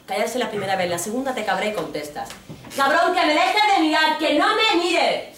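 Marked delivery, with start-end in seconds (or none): 2.8-5.4 high, loud, slowing snap at a man; angry retort. Retold or imitated. Woman snaps back at a staring man, loud and high. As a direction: tell him off, fed up. Retold answer to street harasser.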